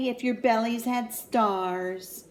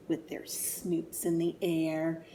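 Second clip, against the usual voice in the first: plain reading voice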